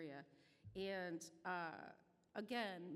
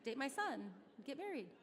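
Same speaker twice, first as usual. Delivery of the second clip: higher, louder, eager barrage of questions; nosy rapid-fire questions; 5 semitones higher, 5 dB louder than usual. Retold or imitated. Mom grilling her son's girlfriend with eager questions. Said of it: "I'm like".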